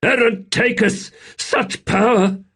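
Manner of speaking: high, loud cry of awe; awed shout. Acted. A soldier reacting to the sorcery, shouting in awe. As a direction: shout it out, stunned by the magic. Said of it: "A soldier shouted".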